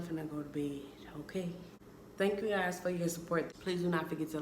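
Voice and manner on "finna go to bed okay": whisper. Real, not acted. Baby sleeping nearby.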